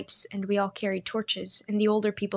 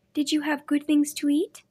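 put-on young girl voice; 6 semitones higher than usual. A narrator's reading. Girl's question.

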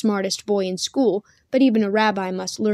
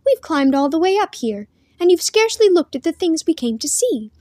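put-on higher-pitched girlish complaint; lightly petulant, pouting girl; childish pout; 7 semitones higher, 4 dB louder than usual. A narrator's reading. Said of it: "with a trace of childish pout".

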